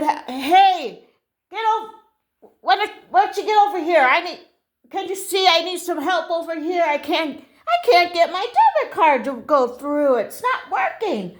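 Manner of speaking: high, loud, flustered demand for help; acted exasperated customer; making a scene. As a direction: call out indignantly to a distant attendant. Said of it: "you don't need to make a scene".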